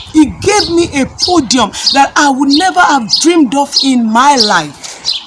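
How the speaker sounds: loud, high climactic preaching; full-force preaching. Acted.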